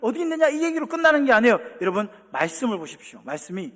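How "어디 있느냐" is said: much higher, louder shout; God shouting from heaven, not coming down. Pretend. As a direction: yell down from the sky. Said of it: "소리 지르시는 분이 아니에요".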